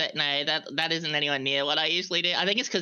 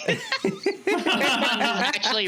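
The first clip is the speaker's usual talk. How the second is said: laughing; 12 semitones higher, much slower than usual. Real, not acted. Laughing while mocking Punz.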